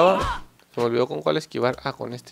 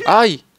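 sharp yelp; 5 semitones higher, 7 dB louder than usual. Real, not acted. Hit in the game.

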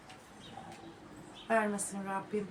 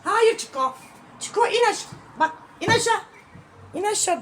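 high, loud sharp calls; alarmed shouting at the dog climbing up; calling the dog; 10 semitones higher, 9 dB louder than usual. Real, not acted. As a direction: yell at the dog to get down. Dog jumping on the chair.

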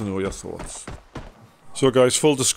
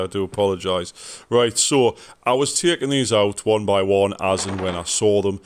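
normal talking voice

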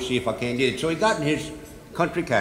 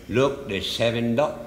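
normal talking voice

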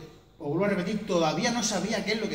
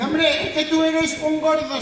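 higher, slow put-on doctor voice; imitated doctor; 7 semitones higher, 4 dB louder, slower than usual. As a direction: drag it out like a smug doctor. Médico explica, voz imitada. (imitando al médico, lento y agudo)

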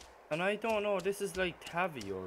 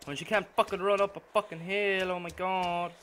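angry rant; 5 semitones higher, 8 dB louder than usual. Real, not acted. Ranting at slow character.

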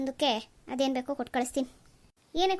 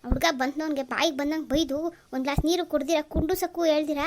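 ordinary delivery

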